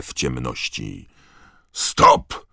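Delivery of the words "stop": very loud roared command; one explosive bellow. A narrator's reading. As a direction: roar it to halt everyone. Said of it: "ryknął głos".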